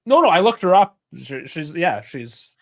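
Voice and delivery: ordinary delivery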